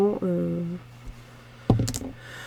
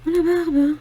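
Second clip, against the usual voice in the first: louder, higher la barbe, fed up; exasperated outburst; 8 semitones higher, 5 dB louder than usual. Real, not acted. Counting coins for viewers, bursts out exasperated. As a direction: throw out la barbe in frustration. Still short of change again.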